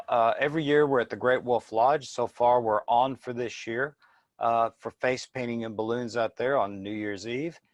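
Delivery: ordinary talk, nothing marked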